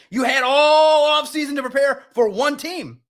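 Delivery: really letting it rip; frustrated shout. Real, not acted. Host scolding the team over the opener, shouting. Host ranting about opening week loss.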